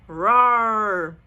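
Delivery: very loud, sustained roar; big growling storybook roar; lion roar. A narrator's reading. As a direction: roar to stop the crowd. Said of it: "roared the lion".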